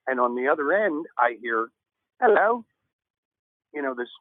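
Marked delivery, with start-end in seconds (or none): 2.2-2.6 a lightly put-on British hello, raised and rising; mock British hello, pitched up with a lift at the end; British accent. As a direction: imitate a polite British caller's hello.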